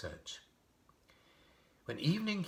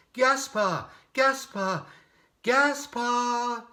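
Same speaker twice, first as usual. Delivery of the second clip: high, loud, drawn-out calls across the snow; shouting a name; 12 dB louder, much slower than usual. A narrator's reading. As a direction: call out for your lost comrade. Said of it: "And again Ulrich shouted".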